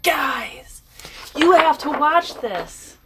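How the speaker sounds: imagined excited viewer. Acted. Viewer telling friends about this video, excited. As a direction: urge them to look, slowly and emphatically.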